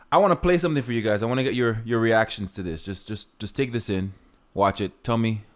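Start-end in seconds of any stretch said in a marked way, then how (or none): none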